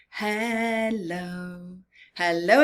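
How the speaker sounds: ordinary delivery, nothing marked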